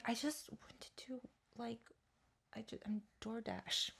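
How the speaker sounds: imitating a meek, near-whispered excuse; very soft, halting mock-timid reply; vulnerable. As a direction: shrink into a tiny apologetic voice. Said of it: "I was feeling really vulnerable".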